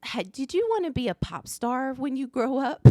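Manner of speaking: put-on high little-kid voice; mimicked small girl with a question; daughter's child voice. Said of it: "our daughter goes".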